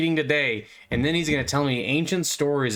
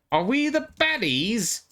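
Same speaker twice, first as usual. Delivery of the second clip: much higher, slow, puzzled question; a dark elf suddenly doubting his side, drawn out; dawning doubt; 11 semitones higher, slower than usual. Retold or imitated. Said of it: "dark elf that just starts to go".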